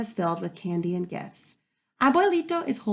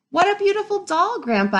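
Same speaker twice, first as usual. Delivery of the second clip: high, louder thrilled thanks; delighted thanks; 10 semitones higher, 6 dB louder than usual. Acted. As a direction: gush over the gift to grandpa. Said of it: "I tell him".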